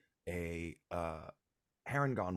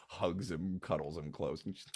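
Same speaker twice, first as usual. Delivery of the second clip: ordinary delivery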